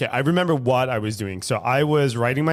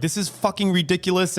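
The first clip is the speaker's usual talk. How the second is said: replaying the anger at God on the bike, pitched up; angry quote raised in pitch, not shouted; mock angry yell; 8 semitones higher than usual. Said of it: "I was just so angry".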